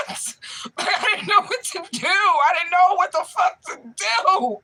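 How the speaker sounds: high, laughing and half crying; helpless laughter through the words. Real, not acted.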